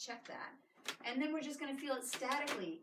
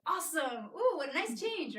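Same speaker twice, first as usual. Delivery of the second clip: bright, loud, higher burst of delight; excited praise; 6 semitones higher, 8 dB louder than usual. Retold or imitated. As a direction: cheer like you just felt a great change. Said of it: "you hear me say".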